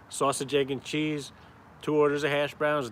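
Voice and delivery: ordinary talk, nothing marked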